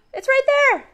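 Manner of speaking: high, fast excited discovery; gasping surprise. Real, not acted. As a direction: gasp and point it out.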